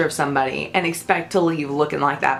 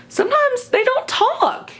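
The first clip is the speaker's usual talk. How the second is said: pitched way up and slowed, mock eerie; high squeaky aside. Stylist mocking a staring client, high slow squeak. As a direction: squeak it out slowly like a creeped-out stylist.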